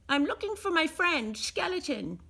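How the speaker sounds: a small hopeful goblin asking. A narrator's reading. Goblin voiced in story.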